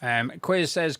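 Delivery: ordinary talk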